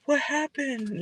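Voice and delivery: gasp, then a small high what happened; hammed-up innocent surprise; mock shocked gasp. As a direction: play dumb with a fake gasp. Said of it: "you wanna act like".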